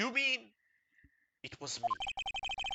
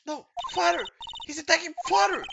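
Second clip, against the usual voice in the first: high, loud horrified cry; boy watching his father attacked, crying out; horrified child cry; 8 dB louder than usual. Acted.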